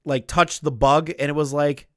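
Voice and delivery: ordinary talk, nothing marked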